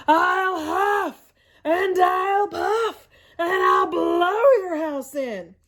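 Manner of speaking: slow, loud, drawn-out villain voice; big bad Coyote threat. Coyote menacing the javelinas, slow and loud. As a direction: stretch every word like a hungry predator. Coyote tries a third time.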